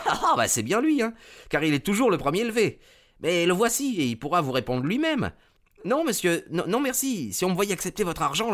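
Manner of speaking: high-pitched, rapid stable lad with a laugh; talkative groom. Acted. Groom answers Holmes and declines his money, high, hurried chatter.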